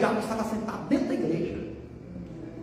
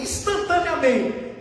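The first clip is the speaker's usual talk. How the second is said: ordinary delivery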